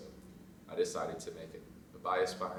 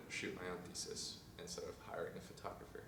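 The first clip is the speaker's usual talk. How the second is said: whispering; 10 dB quieter than usual. Real, not acted. Whispered aside.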